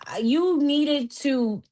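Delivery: ordinary talk, nothing marked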